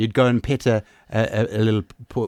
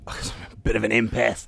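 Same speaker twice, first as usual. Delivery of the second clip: whispered aside; 2 semitones lower, 8 dB quieter than usual. Real, not acted. Whispered aside mid-remark.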